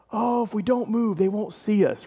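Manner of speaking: high, put-on voice of scared guards; frightened guards, mock voice. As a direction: play terrified guards frozen on the spot, hammed up. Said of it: "The guards shook with fear".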